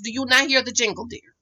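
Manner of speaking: normal talking voice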